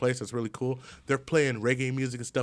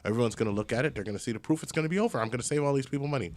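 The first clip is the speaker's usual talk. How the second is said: ordinary talk, nothing marked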